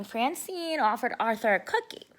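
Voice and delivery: ordinary delivery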